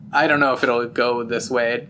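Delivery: ordinary delivery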